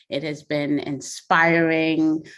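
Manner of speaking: ordinary talk, nothing marked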